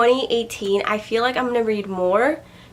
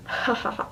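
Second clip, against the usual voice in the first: soft, breath-only chuckle; short laugh; 7 dB quieter than usual. Real, not acted. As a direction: let out a small quiet laugh. Laughs after admitting unfinished series.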